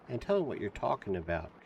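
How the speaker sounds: ordinary delivery, nothing marked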